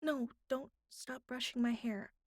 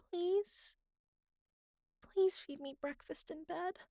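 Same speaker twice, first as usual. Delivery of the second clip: higher, sweetly begging, with Please lifted and a rise at the end; pleading; 7 semitones higher than usual. Acted. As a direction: give in and beg cutely for breakfast. Gives in and repeats the request.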